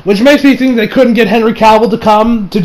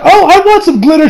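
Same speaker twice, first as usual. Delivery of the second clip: put-on little girl voice; 10 semitones higher, 4 dB louder than usual. Little girl wanting glitter.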